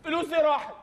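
ordinary delivery

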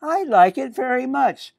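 put-on high, pleased little-girl voice; Millie. Millie tells the man she likes the hat, high girlish voice. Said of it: "said Millie".

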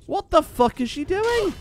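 high, slow, disbelieving what the fuck; drawn out in sheer confusion at the attack; baffled outburst. Real, not acted. Gamer watching an NPC suddenly turn hostile, baffled and drawn out.

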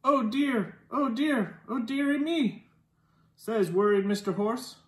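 usual speaking voice

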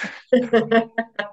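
high burst of laughter. Real, not acted. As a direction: laugh at your own mock quote. Se ríe de su imitación. (risa aguda)

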